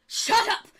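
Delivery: high, loud royal outburst; king's shout. Acted. King silencing his gasping court, acted shout. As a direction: silence the crowd as an angry king. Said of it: "And the king goes".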